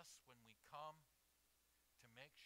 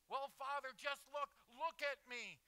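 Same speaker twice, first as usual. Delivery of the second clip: loud, high pleading; insistent plea; 12 semitones higher, 14 dB louder than usual. Acted. Sinner begs the Father to look, loud insistence. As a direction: urge it hard, voice way up.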